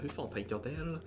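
quiet muttered thought. Retold or imitated. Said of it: "jag tänkte bara".

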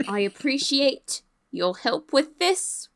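slow and deliberate, every word said out; clear repeat. Acted. Captain repeating her thanks to Jack, slow and clear. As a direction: repeat the thanks slowly so it can't be missed. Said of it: "I don't think I heard that correctly".

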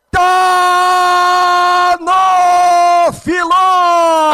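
long held goal shout; long goal call. Acted.

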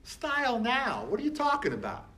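high, disbelieving outburst; incredulous what. Retold or imitated. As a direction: throw up your hands in disbelief. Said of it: "I'm going".

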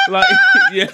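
high laughing burst. Real, not acted. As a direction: break into a laugh. Laughing at [name] clip.

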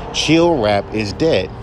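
usual speaking voice

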